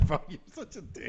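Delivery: high cackle into laughing words; laughing at a friend's dig, high squeal then fading; real laughter. Real, not acted. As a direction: crack up at the jab.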